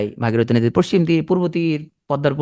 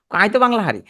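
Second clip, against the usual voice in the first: put-on high, playful Noakhali accent, হারি instead of পারি; 7 semitones higher than usual. Teacher retells a colleague's boast to students, in a teasing Noakhali accent. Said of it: "ও এসে আমার সাথে নোয়াখালী কথা বলতেছে".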